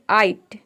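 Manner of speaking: "आइट" as one blended syllable, GH silent; pronunciation demo. Shown as a demonstration. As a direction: sound out "आइट" for learners. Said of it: "इन चारों को मिलाकर हम pronounce करते हैं".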